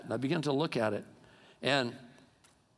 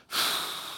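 long breath out before answering; acted resigned sigh. God answering too-late cries for help, an acted heavy sigh. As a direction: sigh as if nothing can be done. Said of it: "I'll sigh and go".

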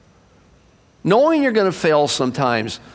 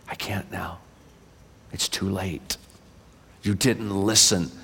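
lower, much softer, fading whisper; sorrowful whisper; 12 semitones lower, 8 dB quieter than usual. Acted. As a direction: whisper it like final bad news. God whispering sad refusal.

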